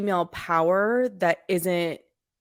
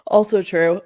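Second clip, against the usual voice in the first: normal talking voice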